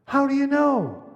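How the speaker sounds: high, loud, startled question; astonished. A narrator's reading. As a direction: blurt it out in amazement. Boy demands in wonder.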